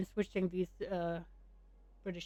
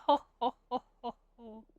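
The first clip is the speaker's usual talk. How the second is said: acted chuckle; 2 semitones higher than usual. Friend joking about tarot prediction, acted chuckle. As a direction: chuckle in character, fading out. Character laughs at own joke.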